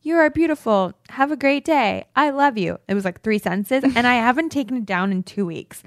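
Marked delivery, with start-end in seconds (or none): 0.0-2.8 lightly higher, tender read; sweet note reading. Retold or imitated. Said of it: "it said".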